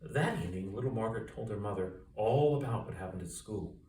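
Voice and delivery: ordinary delivery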